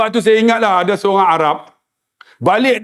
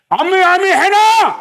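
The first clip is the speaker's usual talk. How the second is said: urgent calling; 10 semitones higher than usual. Retold or imitated.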